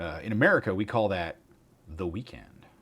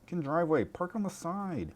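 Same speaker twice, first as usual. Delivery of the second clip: mock scolding; 10 semitones higher than usual. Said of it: "I'm like".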